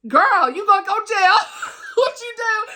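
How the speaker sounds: high, loud, laughing call-out; laughing scold. Real, not acted. Reviewer laughingly scolds the cousin character, high and loud. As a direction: scold her through laughter.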